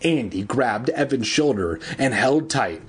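usual speaking voice

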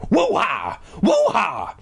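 high spelt-out breathing noise; loud breathing imitation. A narrator's reading. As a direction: act out huge breaths as silly words. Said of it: "sounded like someone breathing".

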